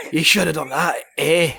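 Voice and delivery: lightly voiced Scottish accent. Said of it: "the pure Scottishness".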